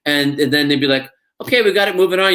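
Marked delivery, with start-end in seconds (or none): 1.4-2.3 higher, brisk impression of the crew. Imitating the crew after a take, higher and brisk. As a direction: rattle it off like a crew member hurrying on.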